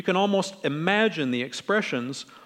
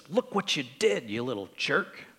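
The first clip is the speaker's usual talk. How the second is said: disdainful; 5 dB quieter than usual. Retold or imitated. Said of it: "looks at him with disdain".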